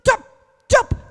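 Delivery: scolding hush. Acted. Crowd scolding the blind beggar to shut up, sharp hush. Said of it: "डांटने लगे".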